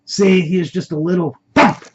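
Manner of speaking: cocky taunt, punched out loud; gloating. Acted. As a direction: crow over a beaten man. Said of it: "gloatingly said".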